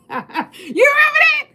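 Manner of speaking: high, laughing through the words; giddy laughing question. Real, not acted. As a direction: cracks up remembering the song. Laughs after singing.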